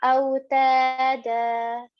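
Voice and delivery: long, level held دا to close; four-count elongation. Shown as a demonstration. Teacher showing the end of the ayah, long held دا. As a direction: draw out the final دا of أو تا دا for four counts. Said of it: "extend it for about four counts".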